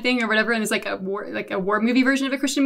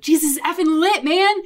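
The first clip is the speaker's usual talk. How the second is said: excited; 5 semitones higher than usual. Retold or imitated. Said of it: "And they're like".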